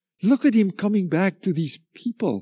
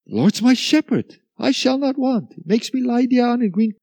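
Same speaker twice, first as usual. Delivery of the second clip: higher, hurried recital of the psalm; rattled-off reading; 5 semitones higher than usual. Retold or imitated. Sleepy believer rattling off the psalm before bed. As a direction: rattle off the psalm to get it over with. Mock rushed Bible reading.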